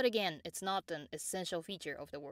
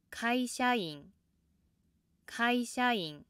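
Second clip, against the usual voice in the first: slow 会社員, high from the first sound, then down; start high; 5 semitones higher, 4 dB louder, slower than usual. Shown as a demonstration. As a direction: hold 会社員 high until the downstep near the end. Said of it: "which start with the high pitch".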